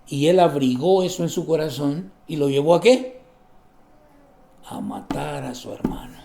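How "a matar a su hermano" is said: whispered. Real, not acted. Dramatic climax of murder.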